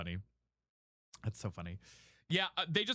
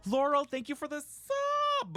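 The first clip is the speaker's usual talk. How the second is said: excited gasp; 11 semitones higher, slower than usual. Real, not acted. Gasps at a new subscriber.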